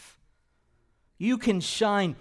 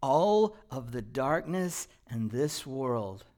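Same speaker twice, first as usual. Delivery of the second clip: normal talking voice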